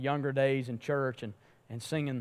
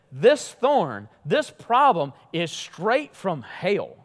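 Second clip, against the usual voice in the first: emphatic complaint; 3 semitones higher, 7 dB louder, much slower than usual. Acted. Paul's quoted complaint, preached.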